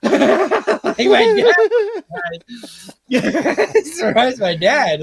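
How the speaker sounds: high, slow, laughing through the words; amused, laughing as he tells it. Real, not acted. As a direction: let the laugh carry every word, slowly.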